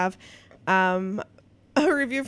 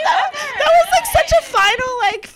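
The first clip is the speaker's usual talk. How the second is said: high, loud gush of thanks; real high-pitched delight; touched gushing; 12 semitones higher, 5 dB louder than usual. Real, not acted. Gushing over a fan's review.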